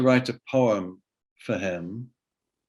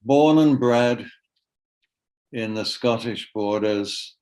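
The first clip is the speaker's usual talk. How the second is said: poem reading. Acted. Poet reads the poem about the mother of his kids, measured poetry reading. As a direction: read in spaced phrases with long pauses.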